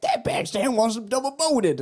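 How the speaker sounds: high, drawn-out soul-singer impression. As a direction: stretch it out high, imitating an excited showman. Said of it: "[name]'s like".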